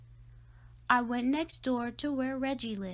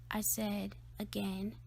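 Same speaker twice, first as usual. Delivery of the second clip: plain reading voice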